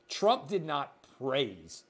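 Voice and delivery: normal talking voice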